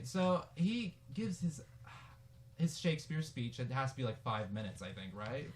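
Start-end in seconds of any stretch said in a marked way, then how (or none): none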